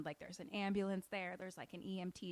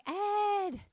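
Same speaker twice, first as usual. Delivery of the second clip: calling out; 13 semitones higher, 7 dB louder than usual. Retold or imitated. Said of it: "she's like".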